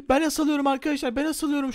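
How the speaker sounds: playful fake fright; mock panic. Character hanging, panicking.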